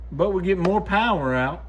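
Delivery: ordinary talk, nothing marked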